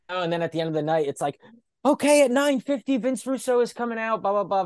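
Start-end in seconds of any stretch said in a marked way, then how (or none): 1.9-4.6 high, hammed-up announcement; mock announcer. Announcing Russo's entrance to the crowd, mock. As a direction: announce it like a cheesy MC.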